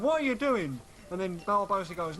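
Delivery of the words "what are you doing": higher, quick startled Jack Sparrow; lightly voiced Jack Sparrow; Jack Sparrow impression. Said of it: "Jack Sparrow's going".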